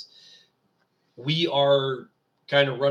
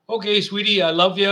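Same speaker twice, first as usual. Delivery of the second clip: louder, affectionate sign-off to his sweetie; loving goodbye to wife; 6 semitones higher, 9 dB louder than usual. Real, not acted. Radio host to his wife calling mid-show, affectionate loud goodbye. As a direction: tell your wife you love her, warmly and loudly.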